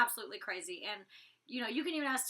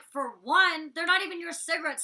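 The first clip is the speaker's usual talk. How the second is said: raised, louder comeback in a retold row; indignant retort; 5 semitones higher, 9 dB louder than usual. Housemate arguing back at Rocky over cigarettes, indignant retort. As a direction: fire back loud and high. Retelling her retort in argument.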